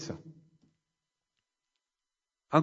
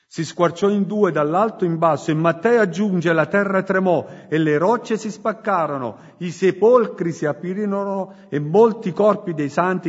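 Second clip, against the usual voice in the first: forceful scripture reading; 3 semitones higher, 3 dB louder than usual. Acted.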